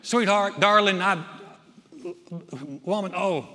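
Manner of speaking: loud, playful imagined Jesus; vernacular endearments. Acted. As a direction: gush in everyday pet names. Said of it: "said it in our vernacular".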